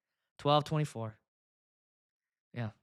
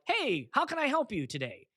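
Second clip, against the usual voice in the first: chirpy bot voice; 8 semitones higher than usual. Retold or imitated. Mocking a generic chat bot.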